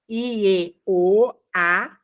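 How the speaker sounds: "Ieoá" split into slow separate vowels, I-e, O, Á; syllable-by-syllable pronunciation. Shown as a demonstration. Teacher spelling out the Hebrew name's sounds, vowel by vowel. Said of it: "a pronúncia".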